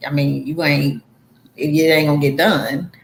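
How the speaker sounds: usual speaking voice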